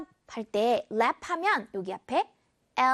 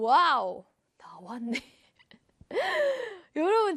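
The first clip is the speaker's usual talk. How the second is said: ordinary delivery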